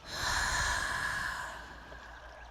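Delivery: long, slow audible exhale; relaxing meditation sigh; deep breath out. Shown as a demonstration. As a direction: breathe out fully to lead the listener. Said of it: "you take a deep breath".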